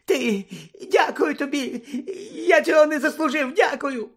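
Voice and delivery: high, squeaky, tearful thanks; cringing, high-pitched gratitude; grovelling Pettigrew. Acted. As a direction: stammer thanks in a whimpering squeal.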